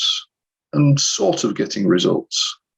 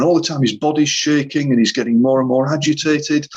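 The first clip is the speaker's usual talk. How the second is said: usual speaking voice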